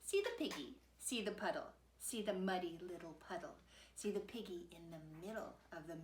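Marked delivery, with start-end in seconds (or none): none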